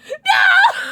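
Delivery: very high, loud cry of no; shocked scream. Real, not acted. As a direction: scream it in real disbelief. A ball dies on stream.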